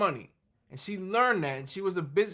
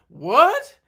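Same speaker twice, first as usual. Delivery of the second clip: high, loud incredulous "What?"; mock shocked friend; terrible impression of Anis; 7 semitones higher, 11 dB louder than usual. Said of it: "I'm doing a terrible impression".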